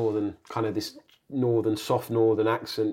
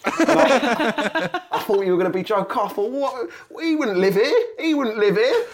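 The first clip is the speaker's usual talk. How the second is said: mock postman; 10 semitones higher, 4 dB louder than usual. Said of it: "they go".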